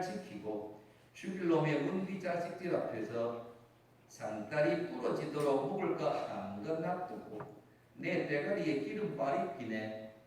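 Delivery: reading the Psalm in a light Gyeongsang dialect. Retold or imitated. Pastor to congregation, reading a Gyeongsang dialect psalm. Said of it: "경상도 버전이라고".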